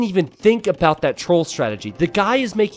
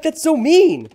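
high, louder indignant protest; playful outrage at his girlfriend; mock indignant; 13 semitones higher, 6 dB louder, slower than usual. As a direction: sound scandalised. His reaction retold.

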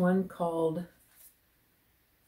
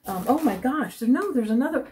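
higher, louder delight; excited discovery; 6 semitones higher, 8 dB louder than usual. Real, not acted. Finding another skein, excited. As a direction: squeal at finding more.

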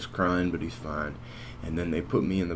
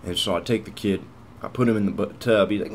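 ordinary delivery

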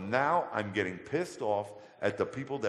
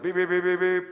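high, loud beeping like a horn; imitated car horn; 8 semitones higher, 9 dB louder than usual. A driver honking to call him over, loud high beeps. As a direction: honk it out like a car.

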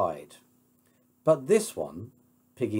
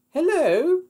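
high, loud phone greeting; 7 dB louder than usual. Acted. Heroine picks up the telephone, high-voiced greeting. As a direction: answer the phone in falsetto.

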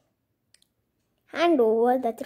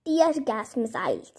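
normal talking voice

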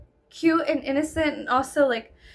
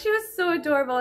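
ordinary delivery

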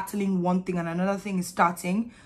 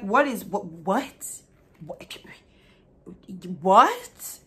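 shocked disbelief; much slower than usual. Retold or imitated. Panicked reaction to pain.

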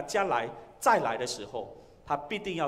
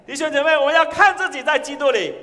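loud, high-pitched exhortation; rousing call; 10 semitones higher, 11 dB louder than usual. Real, not acted. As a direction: raise your voice and rally the congregation. (高声激昂地呼吁会众)

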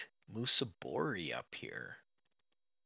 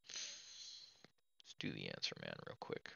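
whisper; 13 dB quieter than usual. Real, not acted. Mutters to himself while choosing.

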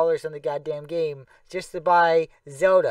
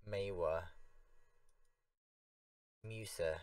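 ordinary talk

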